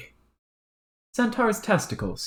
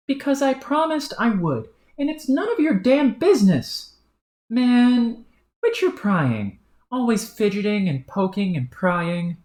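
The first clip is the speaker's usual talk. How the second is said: higher, annoyed rebuke; irritated troll; 11 semitones higher, 4 dB louder than usual. Acted. Troll snapping at his nosy partner, higher and irritated. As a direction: tell him to butt out.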